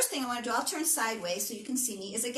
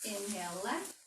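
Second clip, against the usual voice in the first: guided stretch; 4 semitones lower, slower than usual. Acted. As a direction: ease them down into the stretch. Leads a slow stretch.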